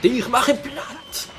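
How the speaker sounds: high, slow laughing threat; laughing mock threat. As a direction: laugh through the boast.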